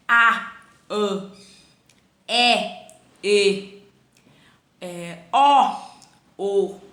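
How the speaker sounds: A, Ã, É, E, Ó, O alternating open then closed; open versus closed vowels. Shown as a demonstration. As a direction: contrast each open vowel with its closed twin: a/ã, é/e, ó/o. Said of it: "é bem diferente". (a/ã, é/e, ó/o alternando som aberto e fechado)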